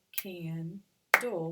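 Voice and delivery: candle in two loud, slow beats; split into syllables. Shown as a demonstration. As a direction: punch out "candle" as can, then dle. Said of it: "whichever way you wanna do your syllables".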